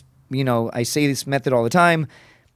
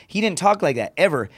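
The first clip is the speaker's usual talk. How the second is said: ordinary delivery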